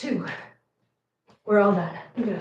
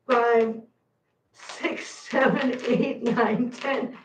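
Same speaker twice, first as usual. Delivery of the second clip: ordinary delivery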